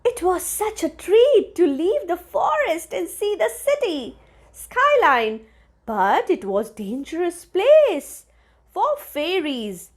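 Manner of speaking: ordinary delivery, nothing marked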